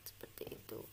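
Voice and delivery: breathy whisper tossed off to the class; soft whispered aside. Real, not acted. Teacher aside after explaining.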